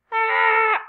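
sharp high loud ah; startled scream. Real, not acted. Gamer screaming as she gets hit. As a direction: yelp in alarm. Sudden cry while playing.

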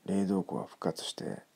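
usual speaking voice

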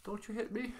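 ordinary delivery

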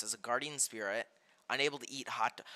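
usual speaking voice

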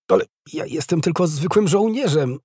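high-pitched, faltering objection; stammered protest. A narrator's reading. Soldier dreading princess duty, stammering a high protest. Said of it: "zająknął się Roszyński".

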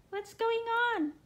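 ordinary delivery

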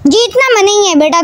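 ordinary talk

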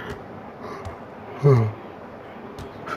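long tired unvoiced sigh; heavy drowsy exhale; sleepy sigh. Real, not acted. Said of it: "sorry, I'm kinda sleepy".

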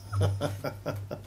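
soft breathy laugh. Real, not acted. Preacher laughs at his own story.

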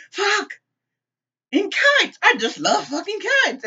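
high, loud squeal of mock delight; overly enthusiastic. Sheila suddenly covering with love of kites, overly enthusiastic. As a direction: gush far too hard, pitch way up. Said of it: "seems so overly enthusiastic about kites".

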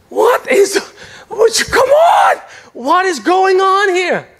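high, slow, shouting protest; outraged shout. Retold or imitated. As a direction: cry out at the injustice. Outraged at rent raise.

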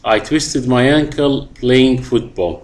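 very slow, clear sentence; teacher dictating; slow model reading. Shown as a demonstration. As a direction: read slowly for students. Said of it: "reads example sentence to class".

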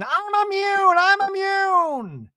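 very high, drawn-out screech; mock screaming. Shannon insisting she is immune, imitated screaming. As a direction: scream it as an over-the-top imitation. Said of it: "Shannon just keeps screaming".

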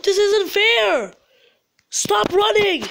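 high, loud, drawn-out complaint; loud wailing frustration; frustrated yell. Real, not acted. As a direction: yell in protest at the game. Yelling at runaway game character.

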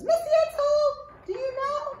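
high, bright little monkey puppet; squeaky puppet voice. Acted. Librarian voicing Arturo the monkey puppet to the children, high excited puppet voice. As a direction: pitch way up and chatter like an excited puppet.

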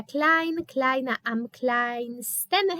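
am kleinsten drawn out slowly; slow read-out. Shown as a demonstration. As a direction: read the student's answer aloud slowly. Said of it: "Pani Bożena napisała". (powolne odczytanie odpowiedzi)

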